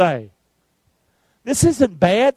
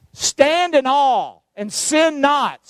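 slow scripture reading; 6 semitones higher, much slower than usual. Real, not acted. Quotes scripture slowly.

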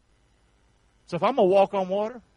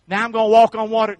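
mock boast; 9 dB louder than usual. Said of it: "It's not good enough just to go".

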